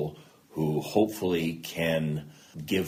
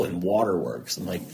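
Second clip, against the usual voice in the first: usual speaking voice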